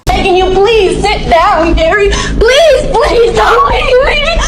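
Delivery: high, crying pleas; tearful begging. Real, not acted. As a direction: sob and beg him to stay. Woman begging Gary not leave.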